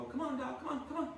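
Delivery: playful dog call. Retold or imitated. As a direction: sweet-talk the dog over. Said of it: "basically leftovers and go".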